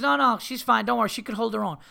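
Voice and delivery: lightly imitated owner waving off concern; a hint of the unworried dog owner; dismissive reassurance. Accountant waving off worry again.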